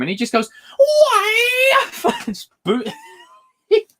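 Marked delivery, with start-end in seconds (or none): 0.8-1.8 high, squeaky mock yelp of "Why?"; squeaky falsetto "Why?"; mock [name] cry. Mimicking [name]' line.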